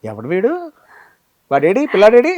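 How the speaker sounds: put-on loud, high call for the missing boy. Voicing the senior actor calling out. (పిల్లాడి కోసం గట్టిగా పిలుస్తూ)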